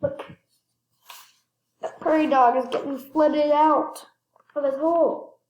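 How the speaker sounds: ordinary delivery, nothing marked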